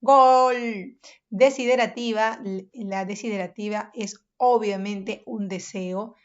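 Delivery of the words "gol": goal shout. Shown as a demonstration. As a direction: yell it like a fan celebrating a goal. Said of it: "Exclamativa es un estado de ánimo".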